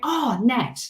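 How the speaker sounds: ordinary talk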